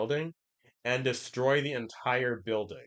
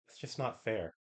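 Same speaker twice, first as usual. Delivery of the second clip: quiet sulky whine; much softer sulking line; soft pout; 8 dB quieter than usual. Retold or imitated. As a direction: sulk it under your breath. Acted quote of Ilia.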